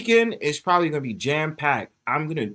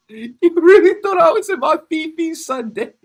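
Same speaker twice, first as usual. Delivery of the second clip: high, loud laughing disbelief; laughing at a viewer who worried on Sunday; 12 semitones higher, 8 dB louder than usual. Real, not acted. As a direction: laugh through the question. Laughing at chatter's worry.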